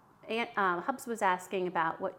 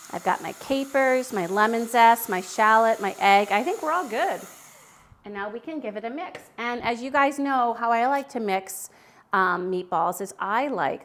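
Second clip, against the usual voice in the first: ordinary talk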